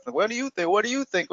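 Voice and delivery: mock back and forth. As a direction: parody the endless back and forth. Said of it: "back and forth".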